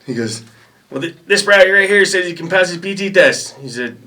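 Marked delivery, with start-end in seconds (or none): 0.9-3.6 high, loud mock interrogation; drill sergeant voice. Other battery's drill sergeant questioning a recruit, high mock bark. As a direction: grill him like a drill sergeant.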